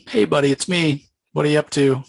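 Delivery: villain Chapel greeting Spawn, mock friendly. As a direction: play the villain's chummy hello, hammed-up. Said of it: "Chapel's like".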